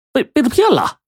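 high, stammering shock; a stammered high-pitched echo of the news; stunned disbelief. Acted. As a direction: blurt it out in disbelief.

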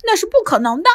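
high scornful huff, then defiant; sulking boy answering his father on the stairs; huffy reply. A narrator's reading. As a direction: stomp off muttering defiance. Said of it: "一边气呼呼地回答说".

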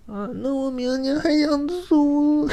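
very high and slow, sugary; high, slow coy falsetto; coy girl voice. Retold or imitated. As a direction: drawl it out coyly. Mimicking the coy tenant.

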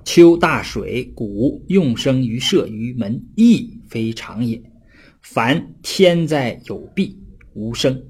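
slow, measured reading of ancient text; classical text recitation. Shown as a demonstration. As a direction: read each phrase slowly like reciting a classic. (缓慢吟诵古文)

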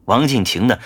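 ordinary delivery

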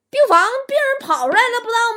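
high, loud mock alarm; hammed-up urgent call. Acted call to a doctor.

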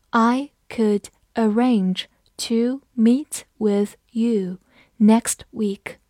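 slow, each word set apart; slow read-along. Shown as a demonstration. Teacher reads the sentence together with learners, slow reading. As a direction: read it slowly, pausing between words. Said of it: "我们慢读一遍".

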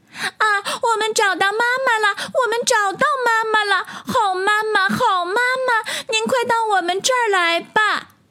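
put-on very high childlike excited voice; joyful tadpoles. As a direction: cheer as tiny tadpoles finding mama. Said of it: "高兴地在水里翻起跟头来". (小蝌蚪欢喜地尖声欢呼)

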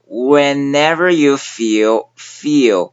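slow, deliberate whenever you feel; 慢速示范; slow drill. Shown as a demonstration. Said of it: "最难的一个小部分就是在whenever".

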